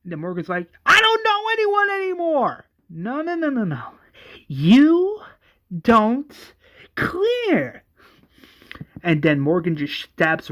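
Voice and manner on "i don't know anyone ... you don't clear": high frantic acted yelling; loud, high-pitched raving denial; crazed screaming. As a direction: scream it, then break into separate shouted words.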